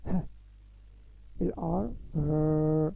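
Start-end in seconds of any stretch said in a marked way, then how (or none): 1.4-2.9 slow stretched ر after ال ار; letter sound demo. Shown as a demonstration. Teacher sounding out letters for kids, slow ر. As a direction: hold the sound ر long and clear. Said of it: "نطقها ازاي".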